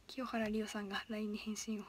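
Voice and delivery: reads the name in a hush; whisper. Real, not acted. Streamer whispering a chat comment aloud. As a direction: whisper it like a secret.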